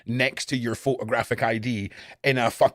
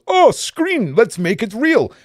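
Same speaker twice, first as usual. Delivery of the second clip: high, louder silly inner voice; brain's voice; 9 semitones higher, 7 dB louder, slower than usual. Retold or imitated. Streamer voicing his brain during horror games, high and silly. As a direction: voice the brain as a giddy prankster. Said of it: "your brain just goes".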